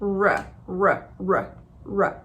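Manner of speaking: R, R drawn out as a curled American R; English R sound. Shown as a demonstration. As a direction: demo the retroflex R, softly. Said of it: "você vai perceber que é R".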